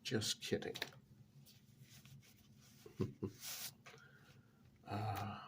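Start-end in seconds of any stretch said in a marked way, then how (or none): 2.8-3.9 soft mocking laugh. Laughs at Edwards' reaction.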